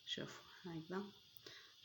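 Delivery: breathy whisper, a quick aside; hushed look-here whisper. Real, not acted. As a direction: drop to a whisper to show them. Aside while showing the dish.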